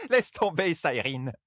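high, laughing brush-off; through laughter. A narrator's reading. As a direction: toss it out on the tail of a laugh. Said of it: "lança Yukiri en riant". (lancé en riant)

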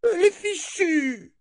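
high shrill squeal. Acted. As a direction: squeal like a spoiled noblewoman. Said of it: "couina dame Ordite".

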